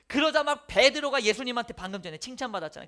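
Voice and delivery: ordinary talk, nothing marked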